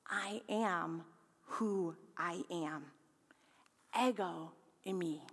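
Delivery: low, slow and soft, a hint of divine gravity; a lightly voiced God, understated and awed; solemn voice of God. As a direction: lean into "I am who I am" slowly and quietly. God speaking to Moses.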